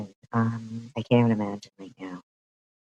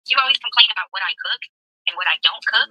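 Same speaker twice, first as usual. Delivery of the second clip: high, loud, very fast sing-song voice; mocking imitation; 9 semitones higher, 4 dB louder, much faster than usual. Streamer mimicking the wife's cooking video, high and very fast. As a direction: rattle it off high and fast like a bubbly cooking host.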